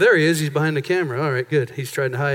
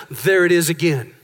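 usual speaking voice

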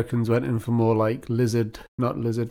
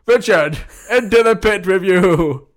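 higher, louder, slow sentence; stern elder's command; 6 semitones higher, 8 dB louder, slower than usual. Pretend. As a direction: banish him into the pit. Elder condemns Richard.

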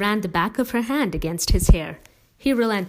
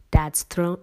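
ordinary delivery, nothing marked

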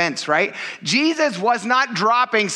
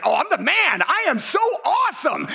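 mock-bragging, rising to a higher shout; hammed-up boast as a show-off Peter; cocky Peter; 9 semitones higher than usual. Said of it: "And then he's like".

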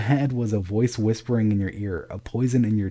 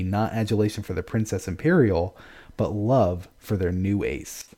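usual speaking voice

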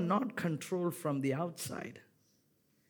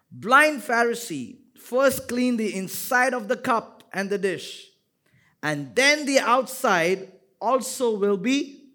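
loud, high preaching voice; preaching at full force; 6 semitones higher, 8 dB louder than usual. Acted. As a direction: proclaim it over the congregation.

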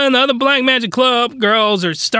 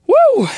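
loud whoo of release; 14 semitones higher, 4 dB louder than usual. Real, not acted. Exhale after rant.

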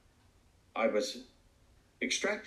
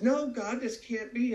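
anguished cry; 13 semitones higher, slower than usual. Retold or imitated. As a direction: cry out to God in disbelief. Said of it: "He said".

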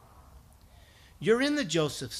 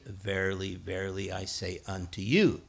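solemn scripture reading; 9 semitones lower than usual. Retold or imitated. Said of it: "Older translations say".

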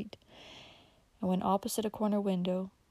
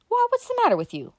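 much higher, louder, alarmed cry; wild shock; 8 dB louder than usual. Acted. Uncle Bobby glaring wildly at Dick, alarmed outburst. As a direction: glare and blurt it out wildly. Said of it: "he glared wildly".

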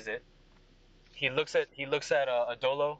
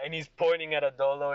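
usual speaking voice

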